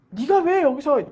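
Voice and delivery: loud, indignant challenge; acted crowd telling off a beggar; scolding. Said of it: "바디메오를 꾸짖었다 그랬습니다".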